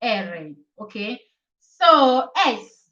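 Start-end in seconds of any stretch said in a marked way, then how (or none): 0.0-0.5 "R" said in two syllables, "EH-reh"; "R" pronounced "erre", lower than usual; Italian letter name. Shown as a demonstration. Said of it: "When you pronounce letter R".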